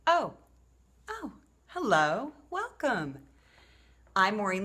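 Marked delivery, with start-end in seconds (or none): 0.1-3.2 high, drawn-out staged hello; feigned surprise. Pretend. Author retaking her welcome to viewers, feigned surprise. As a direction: pretend to be pleasantly caught by the camera.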